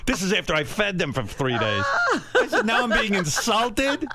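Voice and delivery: high-pitched retold outrage; indignant. Son answering the mother who called him jerk, indignant. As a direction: protest in comic disbelief, pitch jumping up.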